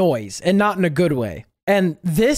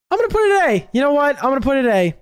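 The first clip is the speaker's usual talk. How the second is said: high-pitched, blurted decision; impulsive declaration; 6 semitones higher, much faster than usual. Real, not acted. Reviewer suddenly changing the ranking, excited declaration. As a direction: announce it high, then settle slightly.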